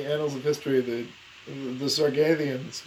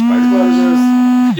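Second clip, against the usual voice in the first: loud; 10 semitones higher, 12 dB louder than usual. Pretend. Punchline of a spider pun.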